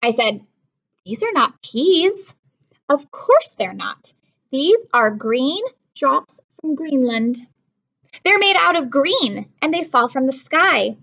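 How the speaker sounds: put-on lively read-aloud to children; storybook reading. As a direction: read it like a picture book to kids. Teacher reads a picture book.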